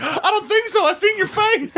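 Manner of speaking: high, laughing put-down; high mocking voice. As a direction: sneer it in a high voice. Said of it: "he's like".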